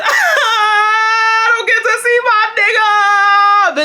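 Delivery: loud, high, drawn-out wail; hammed-up sobbing through the words; mock bawling. Daughter banned from seeing the boy, exaggerated bawling. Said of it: "Bitch, I started crying".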